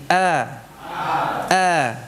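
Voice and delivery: A said thin and open; a light open A; thin fathah A. Shown as a demonstration. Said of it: "Coba ikuti". (A tipis dengan fathah)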